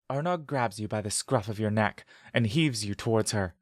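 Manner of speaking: ordinary delivery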